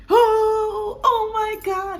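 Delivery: very high, loud excited cry; overjoyed partner. Retold or imitated. Her partner hearing he will be a father, very high and thrilled. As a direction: gush with joy. Partner hears pregnancy news.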